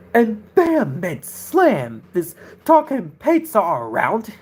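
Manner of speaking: slow, swooping catchphrase delivery; Jackson Ross imitation. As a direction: draw out the words, mimicking his tone. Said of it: "I still try to imitate his tone".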